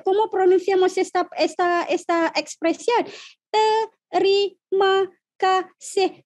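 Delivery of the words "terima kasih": slow terima kasih, each syllable of terima kasih set apart. Shown as a demonstration. Teacher slowly modelling terima kasih for Spanish learners. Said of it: "sílaba por sílaba".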